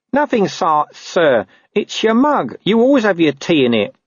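high, squeaky bewildered protest; put-upon underling, pitched high; defensive corporal. Acted. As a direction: explain nervously to the boss. Narrator voices defensive Nobby.

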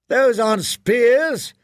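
high, loud, slow shout. A narrator's reading. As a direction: bellow it across the yard. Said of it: "he shouted".

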